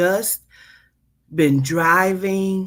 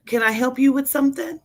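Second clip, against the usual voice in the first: front desk clerk voice; 7 semitones higher, much faster than usual. Acted.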